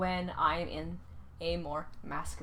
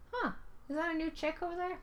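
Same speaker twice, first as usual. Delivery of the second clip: higher, puzzled musing; curious squinting wonder; 8 semitones higher, faster than usual. Retold or imitated. Teen her without glasses eyeing the hallway, higher puzzled wonder. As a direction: wonder aloud, peering down the hallway.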